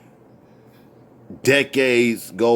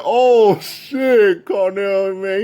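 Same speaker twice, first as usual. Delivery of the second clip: imitated cracking up; 8 semitones higher, much slower than usual. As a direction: exclaim like he's just cracked up. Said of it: "He kept laughing like".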